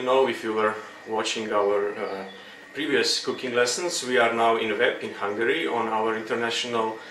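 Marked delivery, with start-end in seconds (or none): none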